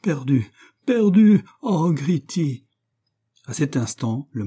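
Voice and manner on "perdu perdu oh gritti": high frightened cry, slowing at the end; terrified stammer. A narrator's reading. Giulia seized by Luca, stammering in terror. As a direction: panic rising then faltering. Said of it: "balbutia avec une folle terreur".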